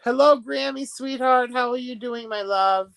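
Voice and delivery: high, bright welcome to a viewer; greeting a regular viewer sweetly. Real, not acted. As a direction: greet Grammy with a lift in the voice.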